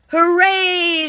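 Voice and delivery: very high, loud hooray; crew cheering. Acted. The crew cheering after the storm, high and loud. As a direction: cheer in triumph. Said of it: "shout the crew".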